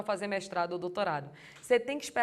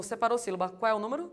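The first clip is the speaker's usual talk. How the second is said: ordinary talk, nothing marked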